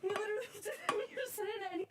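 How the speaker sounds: high, breathless, giddy laughter through the words; laughing excitement. Real, not acted. Rail fan telling the camera after driving the engine, giddy laughter. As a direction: gush it out while laughing. Repeat of same clip.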